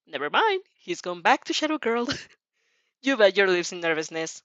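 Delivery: ordinary delivery, nothing marked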